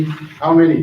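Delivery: normal talking voice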